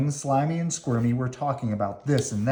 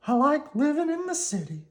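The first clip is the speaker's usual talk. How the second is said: Slimy decided. Acted. Slimy tells Squirmy he's going home, in a high resigned voice. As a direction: admit it with a shrug.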